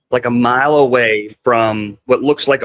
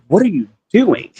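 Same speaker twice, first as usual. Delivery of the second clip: higher, retold alarmed question; alarmed demand; 5 semitones higher than usual. Passenger to driver after swerving from the cliff, alarmed. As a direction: re-enact confronting the reckless driver.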